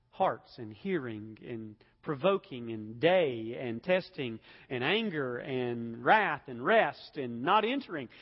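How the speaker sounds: each word laid down slowly, one by one; slow deliberate list. Real, not acted. Preacher to his congregation, slowly listing the text's themes. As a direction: spell out the list of themes at a slow, weighty pace.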